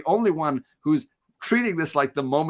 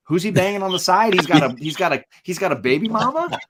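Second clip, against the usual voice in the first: higher and louder mock shock; mock outrage; 6 semitones higher, 4 dB louder than usual. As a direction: ham up a jealous girlfriend's indignation. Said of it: "you get Moira going".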